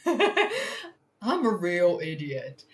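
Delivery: laughing through the words, slowed down. Real, not acted. Streamer laughing at breaking her own tool. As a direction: chuckle it out at yourself.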